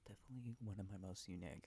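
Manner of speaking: whispering. Real, not acted. ASMR whisper video.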